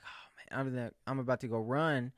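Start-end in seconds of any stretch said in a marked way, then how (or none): 0.0-0.6 whispered dismay; spotting the annoying guy, whispered groan; dreading whisper. Pretend. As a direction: breathe it under your breath, dreading him. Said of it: "I'm gonna be like".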